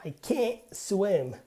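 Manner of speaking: stressed "can't" with the T swallowed; barely pronounced T. Shown as a demonstration. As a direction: stop "can't" short before "swim", T unreleased. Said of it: "the T is subtly pronounced".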